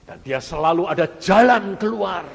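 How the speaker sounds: loud, high, building declaration; rousing proclamation. Real, not acted. Preacher promises God's way out, proclaiming loudly. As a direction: proclaim it out to the whole congregation.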